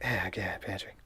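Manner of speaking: ordinary delivery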